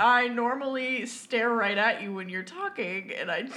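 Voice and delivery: ordinary talk, nothing marked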